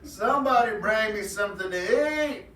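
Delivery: very high, loud, slow wailing rabbit; rabbit crying out. Acted. Lonely hungry rabbit cries out by the pond, high loud wail. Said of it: "As he cried out".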